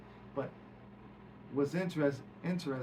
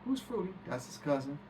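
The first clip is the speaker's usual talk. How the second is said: ordinary delivery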